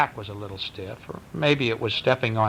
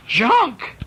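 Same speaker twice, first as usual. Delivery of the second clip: high, loud shocked cry; children aghast at the word; horrified cry; 12 semitones higher, 5 dB louder than usual. A narrator's reading. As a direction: gasp the word in horror. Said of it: "said Hunter and Cheyenne".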